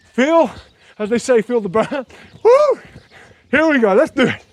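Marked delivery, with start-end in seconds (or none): 2.4-2.8 high loud joyful woo; excited whoop. Real, not acted. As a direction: whoop to fire up the class. Whoop after feel the burn.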